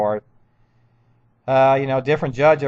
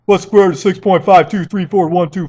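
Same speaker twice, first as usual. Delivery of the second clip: higher, louder put-on voice for the pushy guy; mocking the office guy; 7 semitones higher, 9 dB louder than usual. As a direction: mimic a smug quizmaster barking a question. Hostile office guy quizzes him.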